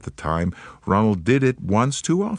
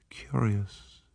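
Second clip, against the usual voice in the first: soft, thoughtful murmur; 10 dB quieter than usual. A narrator's reading. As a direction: say it half to yourself. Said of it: "murmured Poirot".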